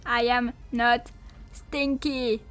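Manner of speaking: ordinary delivery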